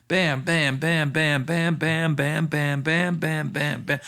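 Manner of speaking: steady, punchy string of bams; rapid-fire bams. Retold or imitated. Albums downloading one after another, rapid-fire bams. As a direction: fire off bams in an even rhythm. Imitates albums roaring through computer.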